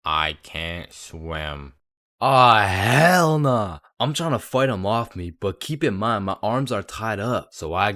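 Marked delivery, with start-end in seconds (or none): 0.1-1.7 slow, low flat deadpan; monotone voice. Retold or imitated. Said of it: "in the most monotone voice". 2.2-3.8 slow, loud drawn-out disbelief; shocked disbelief. Retold or imitated. Reaction to drowning realization.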